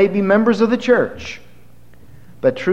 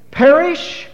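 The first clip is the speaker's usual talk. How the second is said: much higher, louder, ringing out "Never"; fiery pulpit proclamation; preaching at full force; 10 semitones higher, 5 dB louder than usual. Real, not acted. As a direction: thunder the denial to the congregation. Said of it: "That's what Jesus said".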